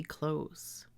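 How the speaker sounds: "close" ending in voiced z; close as CLOZE. Shown as a demonstration. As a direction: say "close" to rhyme with "nose". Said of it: "This one would be".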